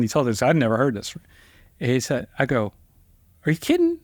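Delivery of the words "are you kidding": much higher, startled disbelief; incredulous squeak. Retold or imitated. Young lawyer doubting his friend's advice, high incredulous squeak. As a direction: sound like you can't believe it.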